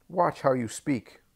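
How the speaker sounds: high, slow menacing threat; threatening warning. A narrator's reading. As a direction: warn him menacingly. Doris threatening.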